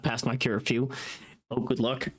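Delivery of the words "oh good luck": ordinary talk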